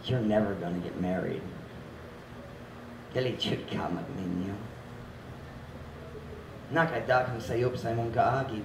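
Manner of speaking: a hint of a sly tempter at the ear; devil whispering. The devil whispering to a single girl, low soft taunt. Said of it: "the devil is constantly whispering in your ear".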